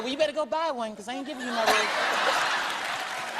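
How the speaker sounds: Michael refusing the car, amused. Retold or imitated.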